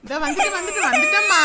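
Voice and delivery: high, loud reassuring call; calling to baby. Acted. Mother rushing back to baby, calling out reassuringly. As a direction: call ahead as you rush over.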